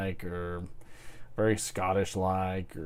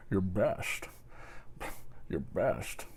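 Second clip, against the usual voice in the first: lower, softer impression of a Scottish actor; Sean Connery; 4 semitones lower, 10 dB quieter, slower than usual. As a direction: put on a low, quiet movie-star impression. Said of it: "Sean Connery's like".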